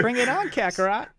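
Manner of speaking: high, cocky anime rival voice; Vegeta voice. Pretend. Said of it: "Vegeta quote, high put-on voice".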